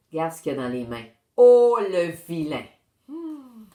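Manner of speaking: usual speaking voice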